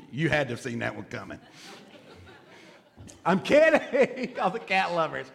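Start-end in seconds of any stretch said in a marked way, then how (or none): 3.3-5.3 laughing. Real, not acted. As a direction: laugh off your own teasing joke. Laughs off his cat joke.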